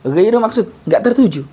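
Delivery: ordinary talk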